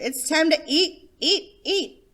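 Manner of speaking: put-on high, loud excited little boy; eager announcement. A narrator's reading. Johnny jumping up to announce mealtime, excited. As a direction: announce it like dinner's finally here.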